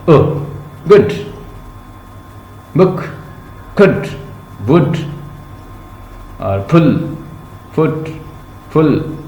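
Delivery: गुड, बुक, कुड, वुड said with a quick short vowel; teacher drilling short vowel words; short oo drills. Shown as a demonstration. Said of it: "थोड़ा सा जल्दी से अ sound".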